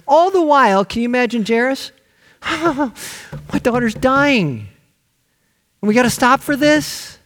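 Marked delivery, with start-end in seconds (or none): 2.4-7.2 higher, drawn-out anguished complaint; slow, pained disbelief at the delay; stressed father. Retold or imitated. Jairus fretting while Jesus stops for the woman, stressed anguish. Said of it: "a guy's gotta be stressed".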